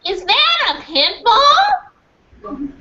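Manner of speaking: high-pitched anxious woman shouting in a parking lot. Acted. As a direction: shriek it out like a panicked mother. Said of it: "high-pitched, anxious voice shouted".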